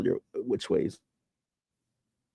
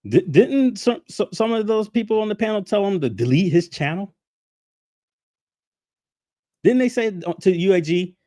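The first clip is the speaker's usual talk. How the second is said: higher, sharp indignant challenge; 7 semitones higher, 3 dB louder than usual. Real, not acted. Ranting at viewers about the panel, indignant and high. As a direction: push the outrage up high.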